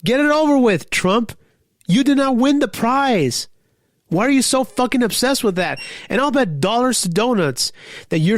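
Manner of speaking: exasperated, jabbing at Trump; angry rant. Real, not acted. Ranting at Trump about prize.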